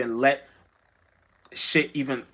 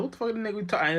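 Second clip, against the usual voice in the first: incredulous; 8 semitones higher, much faster than usual. Retold or imitated. Community member retold, incredulous.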